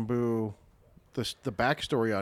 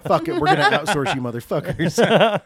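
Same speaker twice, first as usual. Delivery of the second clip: loud, higher callous boss voice; ruthless boss; 7 semitones higher, 11 dB louder than usual. Pretend. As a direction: bark it like a heartless CEO.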